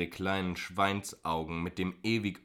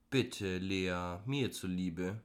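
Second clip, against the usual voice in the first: gentle, coaxing plea; begging; much slower than usual. A narrator's reading. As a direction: lightly wheedle a friend into agreeing. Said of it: "bettelte sie".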